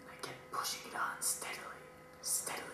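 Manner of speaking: unvoiced suspense whisper. Whispered narration.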